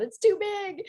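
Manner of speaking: mock alarm. Retold worry about huge waves.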